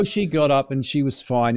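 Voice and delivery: normal talking voice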